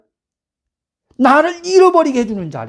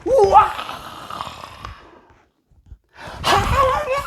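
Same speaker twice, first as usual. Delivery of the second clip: high, restrained mock holler; stifled hallelujah yell, pitched way up; held-back shout; 13 semitones higher, 4 dB quieter, slower than usual. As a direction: cry out in jubilation without full volume. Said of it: "막 소리 지르고 싶어요".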